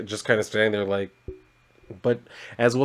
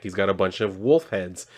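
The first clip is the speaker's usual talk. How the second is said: normal talking voice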